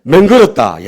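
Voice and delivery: loud, punchy Gyeongsang dialect punchline; comic Gyeongsang-accented proclamation. Shown as a demonstration. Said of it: "경상도 버전으로".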